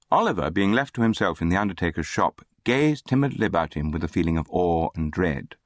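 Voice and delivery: usual speaking voice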